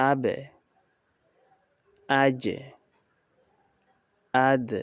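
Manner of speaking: আবে, আজে, আদ with a short echo falling away; downward articulation. Shown as a demonstration. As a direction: bounce the last consonant of আবে, আজে, আদ downward. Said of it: "উচ্চারণটা নিচের দিকে চলে যাবে".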